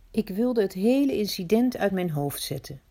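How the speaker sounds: usual speaking voice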